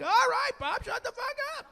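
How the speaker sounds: very high, mock-exasperated outburst; hammed-up, high-pitched "enough already"; exasperated son. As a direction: cut dad off in a squeal of protest. Son snaps at dad exposing him.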